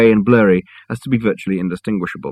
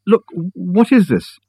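higher, slower demand; 10 semitones higher, much slower than usual. A narrator's reading. Richard blocking the doorway, demanding answers high and slow. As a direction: insist on an answer, pitched up. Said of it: "demanded Richard".

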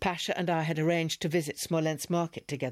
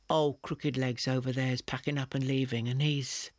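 usual speaking voice

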